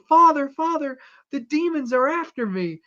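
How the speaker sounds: high, loud, panicked cry for help; frightened plea. Retold or imitated. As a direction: play the terrified woman begging the priest. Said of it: "she's like".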